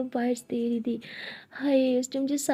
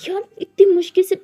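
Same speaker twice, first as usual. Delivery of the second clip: loud, higher frustrated outburst; angry shouting; 5 semitones higher, 7 dB louder than usual. Acted. Siya protests against leaving the restaurant, loud frustrated shouting. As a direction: shout it, fed up with her.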